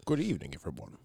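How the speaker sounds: low, soft leprechaun-like brogue; Sean Connery voice. Acted. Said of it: "it's Sean Connery from the grave".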